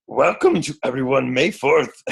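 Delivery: a shaky attempt at Yoda's voice; half-successful Yoda impression; bad Yoda. Host welcoming coaches on May fourth, bad Yoda impression. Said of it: "That was bad Yoda".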